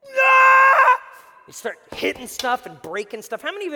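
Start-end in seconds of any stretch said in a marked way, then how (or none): 0.1-1.0 very high, very loud mock rage yell; angry scream. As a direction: let out a hammed-up furious "Ah". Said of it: "you're just like".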